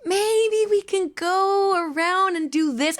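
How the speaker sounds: high, slow, sweet proposal; coaxing suggestion. Retold or imitated. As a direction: pitch it gently, drawn out. Retelling her own suggestion.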